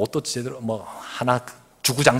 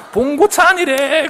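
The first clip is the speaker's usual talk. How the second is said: mocking kids; 7 dB louder, slower than usual. 그러면서 막 깔깔깔깔.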